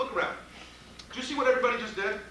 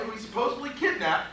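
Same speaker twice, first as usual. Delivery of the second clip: ordinary talk, nothing marked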